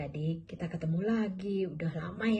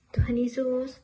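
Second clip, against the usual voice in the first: loud prayer call; 9 semitones higher, 9 dB louder, slower than usual. Real, not acted. Opening a prayer loudly.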